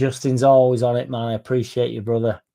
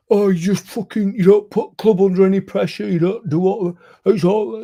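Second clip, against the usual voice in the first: high, louder mocking moan; mock a moaning fan, pitched up; whiny commenter; 8 semitones higher, 5 dB louder than usual. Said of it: "that would be the type of comment that would get".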